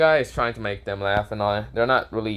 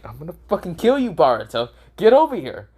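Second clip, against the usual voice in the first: fast, rising to a loud high yell; mock furious threat; 7 semitones higher, 4 dB louder than usual. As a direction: chase him down with a hammed-up rage. Hanabi chasing Boruto angrily.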